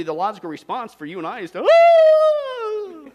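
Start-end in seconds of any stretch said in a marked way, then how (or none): 1.6-3.1 high, loud mock shriek of panic; a hammed-up frightened yelp; mock scream. Mock panic at world government.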